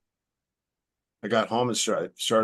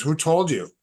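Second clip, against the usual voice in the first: startled; 7 semitones higher, 5 dB louder than usual. Retold or imitated. Caller startled he knew.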